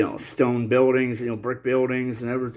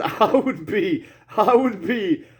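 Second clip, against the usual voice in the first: real nervous laughter breaking through the words; laughing nervously, unable to stop; 7 semitones higher, slower than usual. Real, not acted. Talking to viewers about slavery, with nervous laughter. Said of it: "I am now with nervous laughter".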